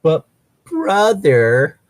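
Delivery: high, slow, wheedling plea; pleading girl voice. Pretend. Reading Rose begging Harry, high wheedling voice. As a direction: stretch it out like a begging child.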